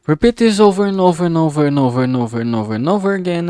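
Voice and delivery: high, loud rush gliding steadily down; rapid-fire repetition. Pretend. Artist telling viewers to repeat the tedious steps, playful rapid rattle. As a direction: rattle it off in one fast run.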